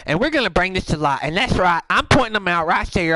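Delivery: ordinary talk, nothing marked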